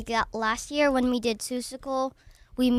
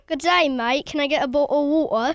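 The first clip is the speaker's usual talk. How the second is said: louder British attempt sliding into Australian; attempted British accent; 3 semitones higher, 6 dB louder, faster than usual. Shown as a demonstration. Kid showing the host a British accent, sounding Australian. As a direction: try British, land closer to Australian. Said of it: "I think that's a little Australian".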